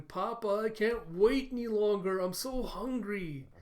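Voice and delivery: put-on high, whiny hungry child voice; Anya. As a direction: plead like an impatient little kid, drawing out the end. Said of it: "you have Anya coming up and going".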